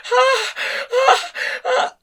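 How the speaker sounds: high moans. A narrator's reading. Woman moans in love scene.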